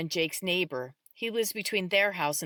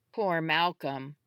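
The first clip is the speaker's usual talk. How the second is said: plain reading voice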